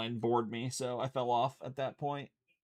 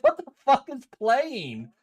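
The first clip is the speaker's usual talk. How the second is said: startled outburst; 13 semitones higher, 6 dB louder, slower than usual. Retold or imitated. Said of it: "I was like".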